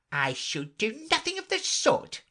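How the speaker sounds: offended mouse. A narrator's reading.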